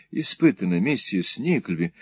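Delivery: plain reading voice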